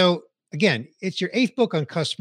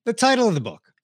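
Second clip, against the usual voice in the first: ordinary delivery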